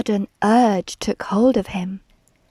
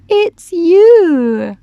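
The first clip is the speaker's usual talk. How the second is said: loud, high drawn-out hoot; high, loud reveal; amused reply; 8 semitones higher, 9 dB louder than usual. A narrator's reading. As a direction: hoot it out merrily. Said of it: "they laughed and said".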